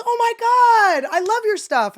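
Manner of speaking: very high, slow gush of delight; gushing fan. Retold or imitated. The chef finally recognizing her, slow high gushing. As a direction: swoon like a starstruck fan. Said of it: "he goes".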